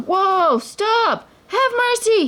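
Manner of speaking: very high panicked pleading; terrified cries. A narrator's reading. The thief on the racing snake, crying in terror. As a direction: scream for mercy while clinging on. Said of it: "he cried".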